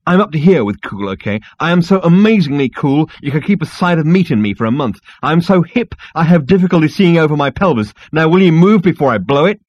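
loud, high, swaggering rant; brash Zaphod, exasperated. Acted. Zaphod rants at the insect receptionist, brash and exasperated. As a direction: boast hotly to bully the clerk.

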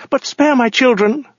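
high-pitched begging woman's voice; terrified pleading. A narrator's reading. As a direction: beg in a very high, frightened voice. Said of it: "stammered the woman".

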